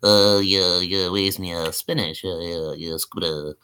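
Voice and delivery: low cartoon sailor voice, trailing down; Popeye. Shown as a demonstration. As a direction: mumble away like a cartoon sailor. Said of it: "Popeye the Sailor Man?".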